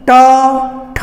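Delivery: ट and ठ said alone with the tongue curled up; retroflex sounds demonstrated. Shown as a demonstration. Teacher sounding out retroflex letters for students. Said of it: "आप ध्यान दे रहे होंगे".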